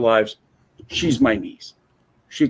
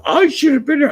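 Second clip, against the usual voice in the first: mock whiny ex; 7 dB louder than usual. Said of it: "she's like".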